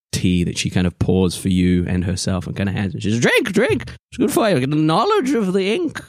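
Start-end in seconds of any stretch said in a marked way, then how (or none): 3.2-6.0 high-pitched character voice urging a drink; eager tea pourer. Acted. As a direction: coax them in a high, eccentric voice.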